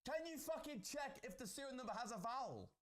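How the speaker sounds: high, exasperated order; heated, high-pitched urgency; impatient demand. Real, not acted. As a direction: demand it through frustration.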